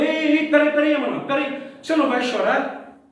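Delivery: chama de volta alto e agudo, espantado; chamado espantado. Retold or imitated. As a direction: segura ele na porta, surpreso e alto. Chefe chama Emanuel de volta, espantado. (chefe chamando Emanuel que sai, surpreso)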